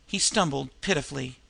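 ordinary delivery, nothing marked

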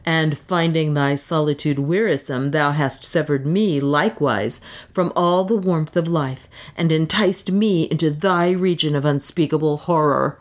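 plain reading voice

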